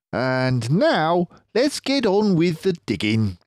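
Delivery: clever fox leader, pleased with himself; Mister Fox voice. Acted.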